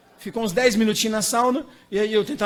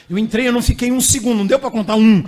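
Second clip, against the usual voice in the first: louder, faster, reliving the shock; louder, excited retelling; 7 dB louder, faster than usual. Retold or imitated. Preacher tells congregation about the icy plunge, loud and fast.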